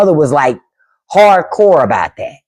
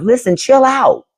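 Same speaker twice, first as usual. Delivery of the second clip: higher-pitched talking-to to oneself; acted self-coaching, pitched up; self-reminder; 8 semitones higher than usual. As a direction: coax yourself to ease up, pitched high. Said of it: "I have to remind myself".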